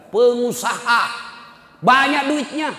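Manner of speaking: "Pengusaha" thrown out high and loud; emphatic repetition. Acted. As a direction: punch "Pengusaha" and "banyak duitnya" high for the congregation. Stressing that the son is rich. (penekanan lantang pada "Pengusaha")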